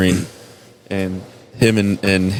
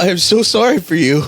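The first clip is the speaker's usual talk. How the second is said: hammed-up sympathy; 8 semitones higher than usual. Retold mock sympathy continues.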